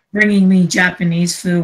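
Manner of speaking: ordinary delivery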